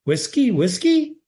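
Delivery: bird call. A narrator's reading. Johnny asking the room for whiskey, high rising bird call. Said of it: "It was like a bird call".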